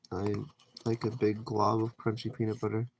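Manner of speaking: ordinary talk, nothing marked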